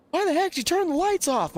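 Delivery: mock Spencer barking on the radio; imitating Spencer. Spencer on the radio asking why, high mock yell. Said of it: "Spencer gets on the radio and he's like".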